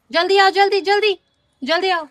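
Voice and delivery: mother urging her son to come quickly; urgent calling. Real, not acted.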